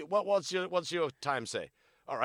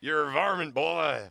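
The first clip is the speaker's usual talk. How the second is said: cowboy taunt; 4 semitones higher, 8 dB louder, slower than usual. Pretend.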